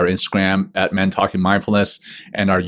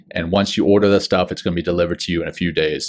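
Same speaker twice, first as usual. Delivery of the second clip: normal talking voice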